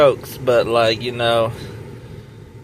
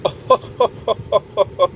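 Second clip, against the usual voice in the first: mocking laugh; 7 semitones higher than usual. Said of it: "He said she was doing".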